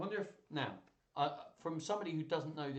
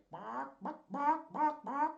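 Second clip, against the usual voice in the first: chickens barking; 11 semitones higher, slower than usual. Retold or imitated. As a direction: squawk the barks up high like a confused chicken. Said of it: "the chickens say".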